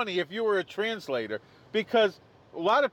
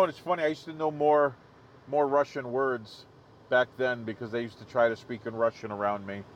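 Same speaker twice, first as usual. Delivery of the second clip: ordinary talk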